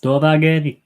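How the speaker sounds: Dorvageri called out loud in a put-on High Valyrian voice; loud showy High Valyrian Dorvageri; High Valyrian phrase. Shown as a demonstration. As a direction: proclaim Dorvageri like a Targaryen command. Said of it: "of High Valyrian that I know".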